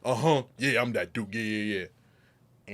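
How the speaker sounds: loud, swaggering hype-man yeah; pumped-up bragging. Retold or imitated. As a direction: puff yourself up like you're that dude. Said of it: "testosterone starts just flowing".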